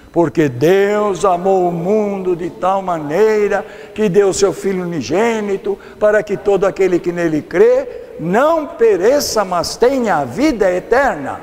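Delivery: loud, measured scripture recitation; verse recitation. Acted. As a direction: lead the congregation through the verse, loudly. Leads congregation reciting a verse.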